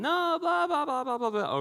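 very high, loud mock child whine; whining kids. Children protesting the bedroom lockout, very high mock whining. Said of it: "complaining and whining".

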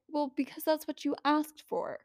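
God shrugging it off; lightly voiced God, understated and dry. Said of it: "And God is like".

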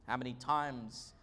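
normal talking voice

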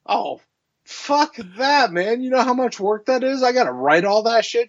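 high, whiny protest; lightly voiced whining friend; mock whiny Joe. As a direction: whine about all the work. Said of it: "Joe's like".

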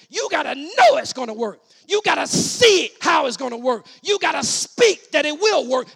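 high, loud, driving sermon shout; full-force preaching. Real, not acted. Preacher tells congregation what Jesus meant about faith, full-force preaching. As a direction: preach it at the top of your voice.

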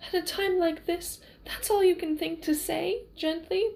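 high-pitched, incredulous questioning; indignant disbelief. A narrator's reading. Lover reproached at their reunion, high and indignant. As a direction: pitch it right up, affronted by the word. Wesley's reproach, voiced by narrator.

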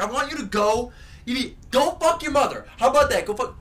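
high, retold taunting yell; yelling into the mic. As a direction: re-enact a taunt shouted into a headset mic. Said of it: "I bring it really close to my face".

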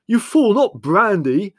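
slow, high, very loud rebuke; furious yell. A narrator's reading. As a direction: yell in horror at the blunder. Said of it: "yelled Leslie".

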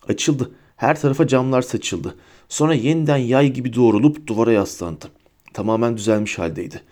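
plain reading voice

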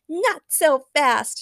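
high, slow, drawn-out call; heroic shout. A narrator's reading. Supergirl swoops in to interrupt, calling out. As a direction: stretch it out as an interruption. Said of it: "shouted Supergirl".

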